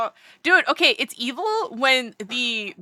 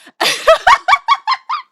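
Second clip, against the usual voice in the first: shrieking giggle; high squealing laugh; 9 dB louder than usual. Real, not acted. Laughing at friend's self-buying.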